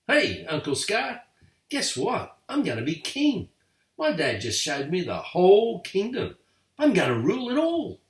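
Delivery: high, eager cub voice bragging to his uncle; a little lion cub showing off, pitched high; boastful young cub. A narrator's reading.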